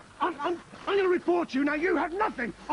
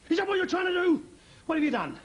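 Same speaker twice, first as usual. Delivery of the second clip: ordinary talk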